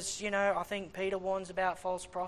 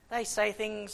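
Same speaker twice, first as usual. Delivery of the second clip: usual speaking voice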